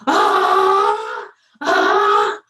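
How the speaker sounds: deep, loud growling scream; fox scream. Acted. Fox screaming with feathers in his mouth. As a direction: roar it like a tickled fox. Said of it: "So he screamed".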